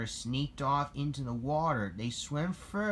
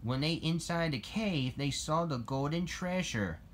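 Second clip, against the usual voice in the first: plain reading voice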